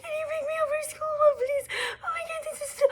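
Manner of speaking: high, wailing sobbed plea to mom; hammed-up crying wail. Teen calling mom from school, wailing and sobbing. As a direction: sob it out like the world ended. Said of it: "I call my mom".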